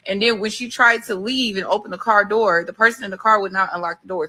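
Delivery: normal talking voice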